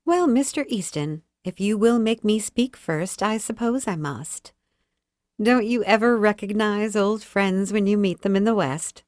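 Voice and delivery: sweet lady's voice, sure of being heard; full, sweet, deliberate. A narrator's reading. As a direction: greet an old friend, pleasantly teasing. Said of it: "full, sweet, and deliberate".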